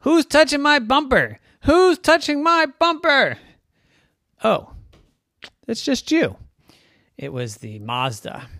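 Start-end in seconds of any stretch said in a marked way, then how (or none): 0.0-3.4 high, loud acted outrage; shouting. A narrator's reading. Mustang shouts about his bumper, outraged. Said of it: "Then the Mustang shouted". 4.4-6.4 high car voice. Acted. Mustang recognises the Mazda.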